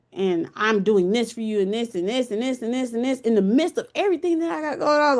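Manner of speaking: ordinary talk, nothing marked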